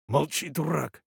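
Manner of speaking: plain reading voice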